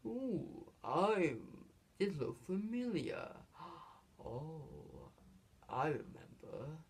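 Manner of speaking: hushed, drawn-out villain musing; mock-musing Scar. Imitating Scar taunting Simba on the edge, softly musing. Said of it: "He's like".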